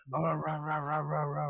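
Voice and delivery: repeated growly dog barking; rah-rah noise of an animal going for it; frantic animal chase. Acted. Tobin chasing a squirrel under the table, frantic barking. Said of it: "He's going for it".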